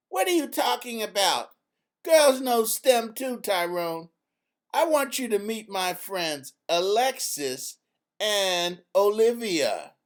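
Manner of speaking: put-on mild indignant girl correcting a friend; Izzy setting him straight. A narrator's reading. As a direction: correct him with a touch of sass.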